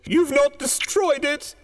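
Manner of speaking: put-on high, urgent old woman; feverish, high-voiced wife; frantic. A narrator's reading. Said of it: "She came stumbling across the room".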